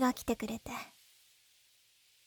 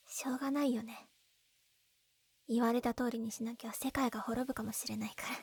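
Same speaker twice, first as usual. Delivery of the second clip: resigned whisper; 3 semitones lower, 8 dB quieter than usual. Acted. Resigned to the forced marriage.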